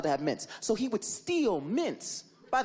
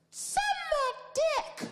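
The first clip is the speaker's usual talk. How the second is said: put-on much higher, slow, drawn-out kid voice; bratty kid insult; much slower than usual. Mean kid insulting the babysitter, high and slow. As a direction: stretch it out like a nasty little brat. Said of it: "And he goes".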